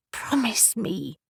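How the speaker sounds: slow, breathy whispered plea; pleading whisper. Acted. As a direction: beg him softly, in a whisper. Briar pleads intimately.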